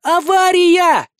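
long, high scream over crowd. Acted. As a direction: yell it over loud music. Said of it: "громко, перекрикивая даже гул толпы и музыку, завопила".

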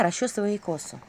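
ordinary delivery

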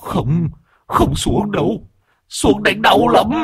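high, slow trembling plea; trembling stammer. Acted. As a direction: stammer it out in terror. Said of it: "run rẩy lắp bắp".

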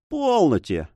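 ordinary delivery, nothing marked

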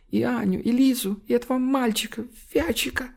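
high, broken tearful mumble; blubbering. A narrator's reading. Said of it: "малосвязное, но наполненное горечью, страхом пережитого, обрывочное бормотание". (сбивчивое бормотание сквозь слёзы)

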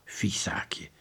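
whispered mutter to oneself; hushed, suspicious aside. A narrator's reading. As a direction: mutter it under your breath. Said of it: "mompelde de speurder".

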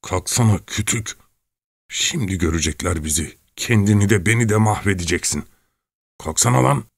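urgent, held-in scolding, pitch jumping up; angry scolding. A narrator's reading. Çelkaş urges the cowering rower up before they are seen, angry. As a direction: scold him to get moving without shouting.

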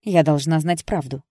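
loud, insistent statement; firm and obstinate; stubborn. A narrator's reading. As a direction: insist on it, refusing to budge. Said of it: "упрямо повторила Джесс".

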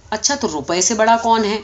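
usual speaking voice

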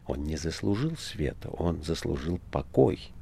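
soft, sorrowful and hushed; sad voice. A narrator's reading. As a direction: let the grief weigh each word. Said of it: "печальным голосом". (печально, тихо)

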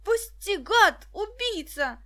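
put-on terrified boy yelling for release; frightened scream. A narrator's reading. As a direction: scream to break free. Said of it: "донёсся до них крик Дениса".

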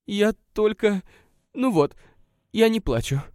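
fighting the tears; holding back tears. A narrator's reading. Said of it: "изо всех сил сдерживая слёзы". (сдерживая слёзы, чуть громче)

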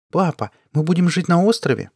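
voiced young girl asking her father; child's question. A narrator's reading. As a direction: lift the voice into a curious child's question. Daughter's question voiced in story.

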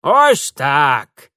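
loud, curt granny remark; emphatic old woman. Acted. Old woman settles matters with Mitya, emphatic and loud. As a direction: round off the threat firmly.

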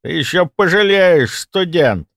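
high, loud parting threat; angry threat. Acted. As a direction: throw it out indignantly as a warning. Orderly threatens as he storms out.

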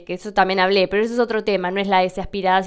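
usual speaking voice

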